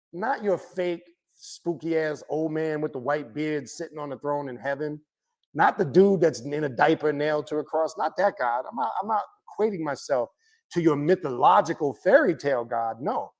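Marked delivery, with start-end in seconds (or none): none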